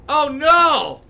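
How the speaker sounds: sudden loud cry of alarm; alarmed shout. Real, not acted. Streamer caught off guard by the game, yelling in alarm.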